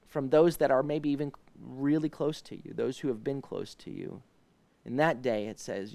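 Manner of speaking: ordinary talk, nothing marked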